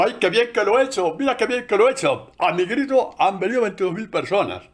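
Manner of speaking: high, loud, smug crowing; proud boasting. Retold or imitated. Gideon boasting proudly to God about his army. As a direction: crow over your own success. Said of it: "Gedeón todo orgulloso".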